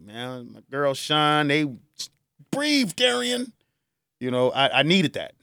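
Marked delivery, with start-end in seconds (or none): 2.5-3.5 slow, high, gentle "breathe"; soothing friend. Retold or imitated. Friend calming a grieving man at the graveside, slow and gentle. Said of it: "she held me down 'cause I was losing it".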